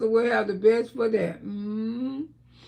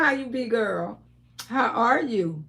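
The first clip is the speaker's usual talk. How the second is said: ordinary talk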